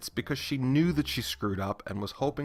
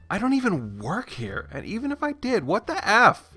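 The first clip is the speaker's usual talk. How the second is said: higher, indignant "what the F"; outraged; 9 semitones higher, 3 dB louder than usual. Retold or imitated. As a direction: let the disbelief boil over. Sister outraged at woman.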